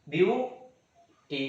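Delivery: Beauty very slow, in two separate beats; beauty pulled apart at the syllable break; split into syllables. Shown as a demonstration. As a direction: say view, pause, then टी. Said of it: "दो बार pronounce करने के बाद".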